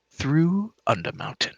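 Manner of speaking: lightly theatrical wizard host; teasing eccentric showman; coy mad mage. Acted. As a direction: lure them into the game with airy assurance. Game master voices scheming mage.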